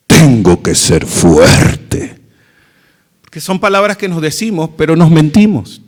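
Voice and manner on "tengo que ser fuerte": very low, slow, loud forced voice; mock tough. Person telling himself not to cry, hammed-up tough voice. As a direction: push it down low and heavy, playing strong. Acted self-talk of toughness.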